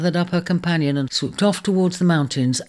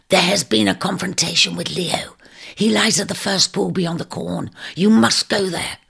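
urgent, pitched-up eagle rasp; rasping whisper; 6 semitones higher than usual. Acted. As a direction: press her in a forceful hoarse whisper. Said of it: "in her low, rasping whisper".

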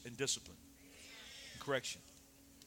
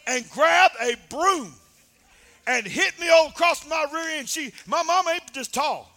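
loud, high storytelling preach; full-force preaching; 10 semitones higher, 13 dB louder than usual. Acted. Preacher telling his football story, loud preaching. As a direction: drive the story hard to the church.

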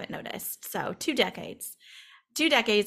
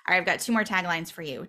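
usual speaking voice

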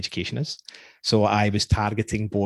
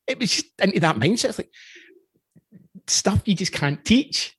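ordinary talk, nothing marked

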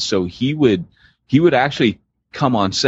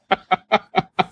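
high real laughter; high giggling laugh; 11 semitones higher than usual. Real, not acted. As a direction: break into giggles. Laughing before the quote.

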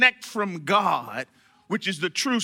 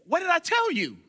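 high-pitched mock scolding; 8 semitones higher than usual. Someone repeating to you when you're not doing right, mock scolding. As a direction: chide them as if they should know. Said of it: "He didn't say it like that. He could have".

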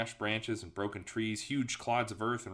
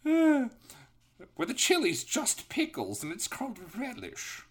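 Tails; 7 semitones higher than usual. Acted. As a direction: keep up the hammed-up British falsetto. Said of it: "He sounds like a distinguished old British gentleman".

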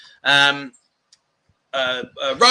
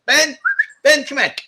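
raised, higher call to the dog; 10 semitones higher, 6 dB louder than usual. Real, not acted. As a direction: call Ben over from across the garden. Calling dog across garden.

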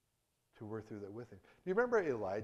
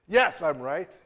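defiant retort; 6 semitones higher, 13 dB louder, slower than usual. Acted. Jonah defiant answer.

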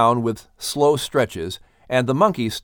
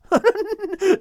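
high hearty seagull laugh; high cackling laugh; seagull cackle. A narrator's reading. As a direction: laugh your head off, much higher. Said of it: "Krynen started laughing his head off".

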